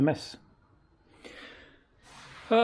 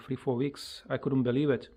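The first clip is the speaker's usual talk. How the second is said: ordinary talk, nothing marked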